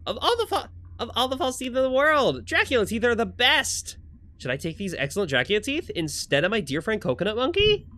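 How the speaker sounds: high-pitched, delighted awe; enthusiastic kid character. Acted. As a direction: marvel over a silly treasure. Voices excited game character.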